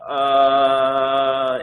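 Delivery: long, loud, high held "uh"; sheepish stalling. Retold or imitated. Client caught out by hairdresser's call, stalling loudly.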